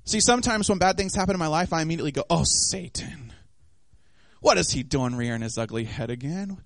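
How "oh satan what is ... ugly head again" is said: mock weary grumble. Preacher voicing someone blaming Satan, weary grumble. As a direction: grumble it low, half to yourself.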